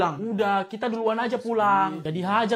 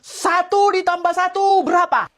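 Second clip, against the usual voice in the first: loud quiz; 8 semitones higher, 5 dB louder than usual. Acted. Teacher quizzing loudly.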